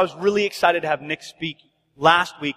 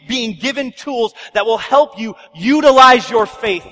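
emphatic sermon climax, punched words; preaching; 3 semitones higher, 6 dB louder than usual. Real, not acted. Pastor closing the series message to congregation, forceful preaching. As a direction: preach it out with force and deliberate weight.

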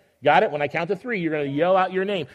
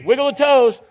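excited cheer; 7 semitones higher, 7 dB louder than usual. Real, not acted. Urging children to wiggle.

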